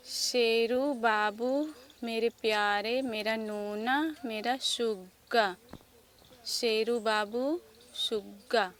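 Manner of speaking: put-on slow, drawn-out, loud reading of a child's note; mother reads son's words on the grave marker, slowly; reading the flag aloud. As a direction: read each pet name out slowly, lovingly. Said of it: "इसमें लिखा हुआ है".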